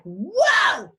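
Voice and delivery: loud, high whoa of waking up; sudden loud realizing whoa; startled whoa. Retold or imitated. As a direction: snap out of it with a big whoa. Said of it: "immediately was like".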